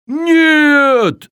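high, loud desperate yell. A narrator's reading. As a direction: scream it out to stop him. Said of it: "завопил викинг".

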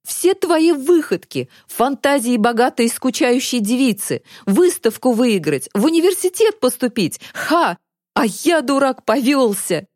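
high-pitched scornful tirade; mock and blame a friend bitterly; bitter accusation. A narrator's reading. Boy bitterly blames his friend. (с горькой злой издёвкой)

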